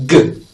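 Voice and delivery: G as a short, hard voiced "guh"; isolated hard G for demonstration; voiced G sound. Shown as a demonstration. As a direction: give G alone, buzzing in the throat. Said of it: "This is voiced".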